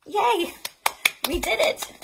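high, loud celebration; excited cheer. Acted. Librarian cheering after finishing the song, excited. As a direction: cheer for the little ones.

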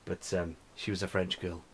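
ordinary talk

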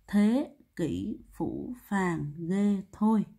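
very slow, word by word; slow recitation. Shown as a demonstration. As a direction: recite the memory phrase with each word drawn out. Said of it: "Cô đọc lại nha". (đọc thật chậm từng chữ)

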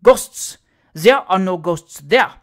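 loud, slow, dismissive; mocking the idea with force; scornful loud scoff. A narrator's reading. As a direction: courier scoffs at the ghost story, loud.

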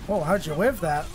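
ordinary talk

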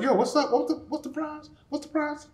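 high, soft, playful questioning; stunned David. Acted. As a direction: pipe up lightly, fishing for the prize. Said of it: "David was stunned, like".

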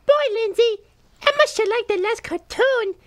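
ordinary delivery

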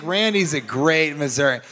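normal talking voice

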